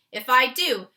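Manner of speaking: loud, vehement warning; loud vehement giant's warning; fierce. Acted. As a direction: press the danger hard. Giant warns of nightmare.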